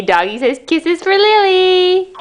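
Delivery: put-on slow, sing-song words for a toddler; slow modeling for toddler. Shown as a demonstration. Mother to toddler, slow and sing-song. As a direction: spell it out slowly for a little one.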